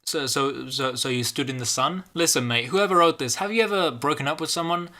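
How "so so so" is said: usual speaking voice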